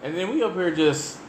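ordinary talk

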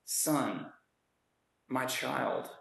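put-on softly calling him my child; gentle father. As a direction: speak to him like a loving father. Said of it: "Notice the gentleness of this response".

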